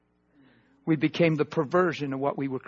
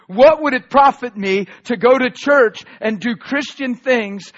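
loud, rising preacher's cry; fiery preaching; 5 semitones higher, 9 dB louder than usual. Real, not acted. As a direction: preach it hard to the congregation. Preaching at full force.

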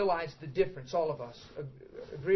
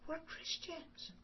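hushed reminder; 10 semitones higher, 16 dB quieter, much slower than usual. Retold or imitated. Girlfriend stops him.

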